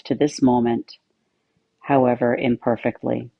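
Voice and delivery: calm, even meditation voice with long pauses; guided meditation. Acted. Yoga teacher settling listeners into meditation, calm guided-meditation delivery. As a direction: settle listeners in with measured, steady phrases.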